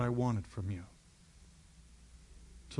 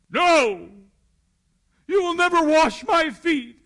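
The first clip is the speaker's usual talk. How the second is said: high, loud, frantic refusal; horrified shout; 15 dB louder, slower than usual. Acted. Peter shouting at Jesus kneeling by him, a horrified loud refusal. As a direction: cry out as if the thought is unbearable.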